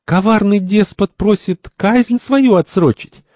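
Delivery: high, slow mocking singsong; jeering. First jeers at Santori's plea, mocking. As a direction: taunt him in a stretched, high voice. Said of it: "Первый глумится".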